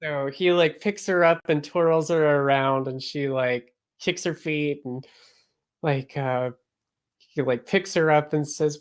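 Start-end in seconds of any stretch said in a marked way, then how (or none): none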